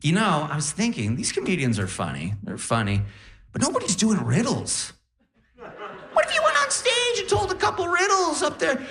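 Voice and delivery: high, silly mom voice pitching an idea; squeaky, eager mom suggestion; imitating mom. Mom pitching riddles to her comedian son, high and silly. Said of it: "My mom then said".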